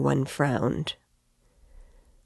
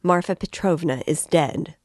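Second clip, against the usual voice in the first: usual speaking voice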